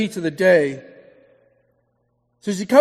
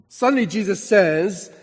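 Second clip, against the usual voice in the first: ordinary delivery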